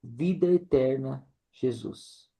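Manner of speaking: soft, dropping to very quiet "Jesus". Shown as a demonstration. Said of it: "agora nós falamos na intensidade menor".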